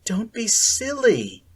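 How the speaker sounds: high, slow friendly scolding; friendly admonishing. A narrator's reading. Sally Ann gently scolding Akira, friendly. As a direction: chide her kindly. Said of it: "admonished Akira in a friendly voice".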